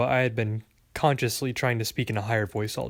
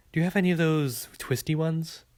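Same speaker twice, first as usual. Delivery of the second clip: higher, lilting question; cheerful customer; 4 semitones higher than usual. A narrator's reading. Girl asking the clerk for candy, cheerful. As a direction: ask eagerly, lifting the pitch.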